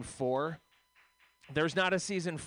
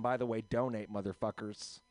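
ordinary delivery

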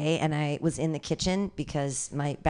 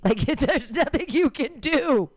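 laughing; 8 semitones higher, 4 dB louder than usual. Real, not acted. Laughs at own joke.

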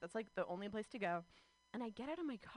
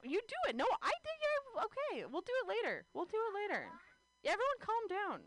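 high, excited laughing banter; giddy stage banter; 10 semitones higher than usual. Real, not acted. Comedian joking with the host on stage, giddy and high. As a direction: bounce it up, much higher.

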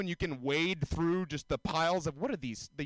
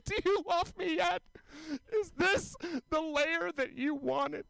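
mock sweet girl; 12 semitones higher than usual. Voices girl peeling skin.

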